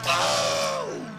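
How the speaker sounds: sudden loud low ow of pain; pained shout. Acted. Weasel hit by bees, pained shout. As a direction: cry out from the sting.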